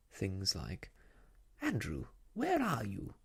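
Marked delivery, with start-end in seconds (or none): 1.6-3.1 high, swooping call to a pet; fussy lady calling out, sing-song; loud calling. A narrator's reading. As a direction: call out for someone missing. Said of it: "in a very loud voice".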